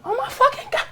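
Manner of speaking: put-on high excited squeal of starstruck girls; squealing fan girls. Girls on South Street spot him, high squealing excitement. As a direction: shriek it like giddy fans.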